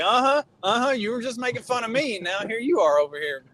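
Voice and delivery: mock gloating at the daughter; teasing gloat. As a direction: rub it in playfully. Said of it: "I was like".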